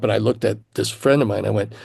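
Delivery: ordinary talk, nothing marked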